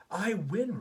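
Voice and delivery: put-on high, loud child's cheer; triumphant yell. A narrator's reading. Reese winning the race to her room, triumphant yell. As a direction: yell it out in victory. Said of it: "Reese yells".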